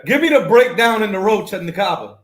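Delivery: loud, high, heckling call; insistent demand. Real, not acted. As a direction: demand an explanation at the top of your voice. Loudly demands an explanation over others.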